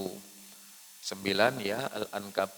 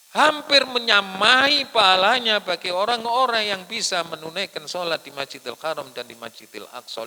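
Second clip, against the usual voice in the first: raised preacher's voice, easing down toward the end; emphatic preaching; 10 semitones higher, 12 dB louder than usual. Real, not acted. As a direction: launch it high and loud, then settle. Preaches at full force. (berkhotbah lantang lalu mereda)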